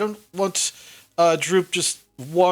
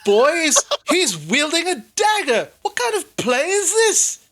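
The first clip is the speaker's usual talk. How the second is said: bewildered Sildar; 9 semitones higher than usual. Acted. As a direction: sputter it out like a baffled adult. Sildar questions the player.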